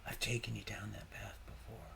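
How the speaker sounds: soft, unvoiced whisper. Real, not acted. Man confiding in his viewers about the path, whispering. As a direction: breathe it to the viewers like a secret.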